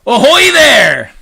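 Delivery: long, high, loud hail; drawn-out high call; police chief's shout. A narrator's reading. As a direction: holler up to someone far above. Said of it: "shouted the chief of police".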